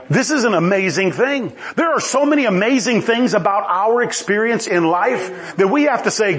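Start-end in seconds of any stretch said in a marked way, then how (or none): none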